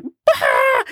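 high comic yelp; mock panicked cry. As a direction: cry out in playful alarm. Retold panic on hearing news.

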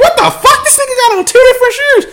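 high, loud, fast mock girl shriek; shocked girl voice. Girl on the curb reacting to his two different shoes, high shocked squeal. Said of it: "She's like".

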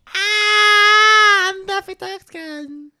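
very high, squeaky cartoon voice; high-pitched cartoon duck. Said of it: "I can't take these high-pitched voices".

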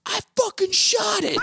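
slow, high-pitched mock scream; imitated screamed confession. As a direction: scream it like a man unhinged. Rocco's screamed answer acted out.

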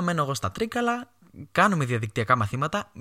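usual speaking voice

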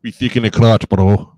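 put-on loud hammy Russian accent; bad Russian accent. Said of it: "the bad Russian accent".

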